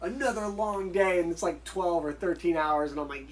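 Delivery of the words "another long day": slow, drawn-out complaint; mock whining. Film extras complaining about long hours, mock whining. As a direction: imitate weary extras moaning. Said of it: "they're crying".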